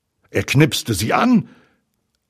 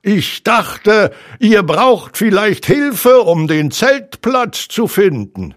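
loud, high owl voice; high, ringing storybook owl; owl character; 11 semitones higher, 6 dB louder than usual. A narrator's reading. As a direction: announce yourself grandly from the treetop. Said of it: "sagte die Stimme".

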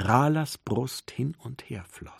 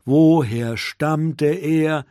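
slow and louder, weighing each word; slow pressured wondering; 2 semitones higher, 7 dB louder, much slower than usual. A narrator's reading. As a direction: press the question slowly and firmly. Rumo asks himself again, pressured.

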